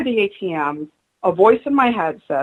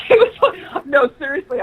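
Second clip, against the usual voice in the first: real laugh breaking through the words; 7 semitones higher than usual. Real, not acted. Guest laughing as she recalls the atheist bus, laughing aloud. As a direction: laugh as you start the line. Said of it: "laughing while telling story".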